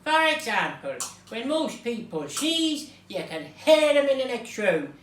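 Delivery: hammed-up Irish storyteller; Irish accent. Reader telling tonight's Mister Noisy book, Irish accent. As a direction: read it out in a broad Irish accent. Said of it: "we'll be doing it in an Irish accent".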